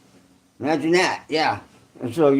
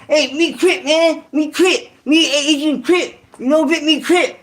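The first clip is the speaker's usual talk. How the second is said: mock Vietnamese accent; 9 semitones higher than usual. Ex-con imitating Vietnamese cellmates claiming Crip, mock Vietnamese accent. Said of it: "They're like".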